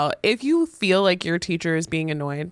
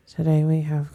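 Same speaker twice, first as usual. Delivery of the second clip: bored eighth grader; 3 semitones lower than usual. Retold or imitated. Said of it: "this eighth grader was like".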